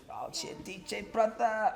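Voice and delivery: a loose impression of how he talks, not full-on; imitating his talk. Teasing his friend by copying his talk, lightly voiced. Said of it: "that's how he talks".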